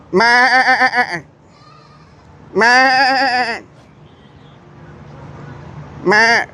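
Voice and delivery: high nasal-free bleating meh; goat bleat. Retold or imitated. Teasing the goats with a bleat.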